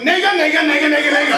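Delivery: loud, high, excited repeated calls; excited dad pointing. Retold or imitated. Dad on the bus spotting New York landmarks, loud excited calls. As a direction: point at each landmark with delight. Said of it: "He's going".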